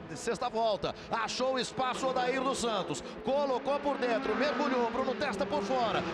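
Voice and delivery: high, fast, urgent race commentary; excited play-by-play. Real, not acted. Excited live race call.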